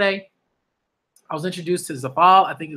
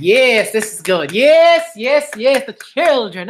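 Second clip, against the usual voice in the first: excited cheer; 5 semitones higher, 5 dB louder, slower than usual. Real, not acted. Cheering the children's progress.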